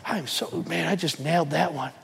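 low, soft mock bragging; mock boaster. Preacher mocking a proud believer, low smug brag. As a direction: play a smug self-congratulating guy.

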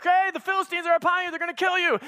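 high, hurried warning shout, half acted; mock alarm call. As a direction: call out the danger quickly. Delilah calls out a warning.

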